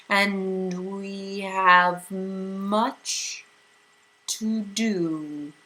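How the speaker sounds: very slow, eerie drawn-out voice; strange otherworldly voice. A narrator's reading. Mrs Which cutting off the bickering, strange and slow. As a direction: speak like a voice from nowhere. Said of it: "the voice said".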